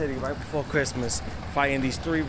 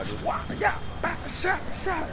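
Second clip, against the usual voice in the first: high mock punch sounds; fight noises. Narrator acting out the fight with high punch sounds. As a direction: make cartoon fight noises.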